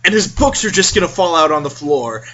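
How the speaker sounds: ordinary delivery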